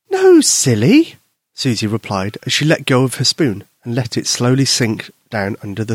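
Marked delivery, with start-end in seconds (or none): 0.1-1.1 put-on drawn-out high, louder child voice; high slow girl. Susie teasing her friend, slow high girl voice. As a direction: tease him slowly, little girl.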